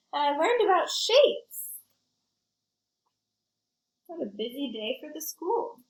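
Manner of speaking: put-on lively read-aloud for kids; story narration. A narrator's reading. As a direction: tell it like a picture book to a class. Reads picture book to children.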